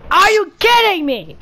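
high, loud exasperated cry; loud disbelieving yelp; outraged. Real, not acted. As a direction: let the outrage crack out high. Outraged at game.

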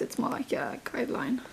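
whispered aside to the camera. Real, not acted. Vlogger whispering her plans to viewers.

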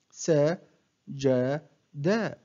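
one short syllable at a time, with pauses; broken into syllables. Shown as a demonstration. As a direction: separate each syllable for a learner to copy. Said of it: "أقطع الكلمة إلى مقاطع قصيرة". (تقطيع الكلمة مقطعًا مقطعًا بوقفات)

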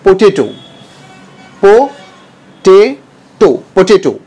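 पोटैटो with the middle syllable drawn out; correct pronunciation. Shown as a demonstration. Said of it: "नहीं, इसको कहेंगे हम". (पोटैटो, बीच वाला हिस्सा खींचकर)

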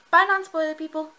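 loud, high send-off; calling out goodbye. Real, not acted. Vlogger shooing off viewers before spoilers, calling out.

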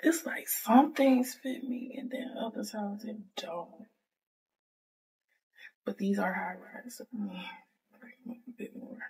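hushed late-night whisper. Real, not acted. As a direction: whisper it, husband's asleep. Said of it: "And I'm whispering 'cause".

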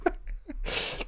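stifled giggle after the call; muffled laugh. Real, not acted. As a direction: hold back a laugh, barely audible.

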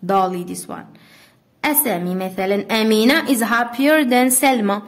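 ordinary delivery

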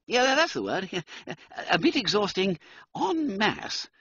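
high toff laughing it off; nervous laughing gentleman; relieved chuckle. Acted. Wooster seizes the word.

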